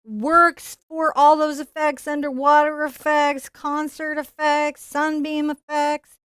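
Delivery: slow emphatic excited list. Real, not acted. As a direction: sell each effect grandly. Enthusiastic list of effects.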